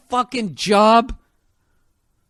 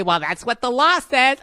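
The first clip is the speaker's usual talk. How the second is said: mocking wife's excuse; 5 semitones higher than usual. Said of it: "other than say".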